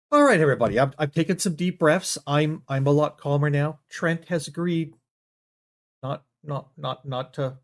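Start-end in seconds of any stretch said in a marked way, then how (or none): none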